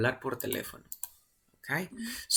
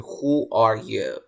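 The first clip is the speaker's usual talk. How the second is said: slow, louder blunt question; very direct; 2 semitones lower, 6 dB louder, much slower than usual. Shown as a demonstration. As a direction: demand directly who is calling. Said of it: "Si tú quieres ser bien directo". (pregunta muy directa, lenta y firme)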